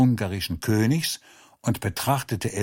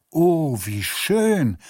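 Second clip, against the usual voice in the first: higher, slow, delighted gasp of joy; 6 semitones higher, 3 dB louder, slower than usual. A narrator's reading. Lotzi answering the king's news with delight. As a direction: savour the happy news. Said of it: "antwortete der Lotzi begeistert".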